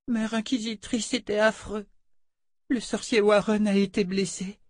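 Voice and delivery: put-on high childlike voice, upset; a frightened little girl telling what happened. Oli tells the Mother Confessor her fear, high child voice.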